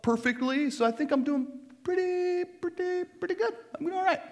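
high, squeaky hedging reply; hammed-up evasive "doing fine"; mock self-satisfied dodge. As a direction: stall in a high put-on voice, dragging words. Mock answer dodging Jesus' question.

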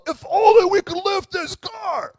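high, loud mock yell. Friends strain at the car, yelling out. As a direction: shout it like a panicked hero. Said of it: "And they yell out".

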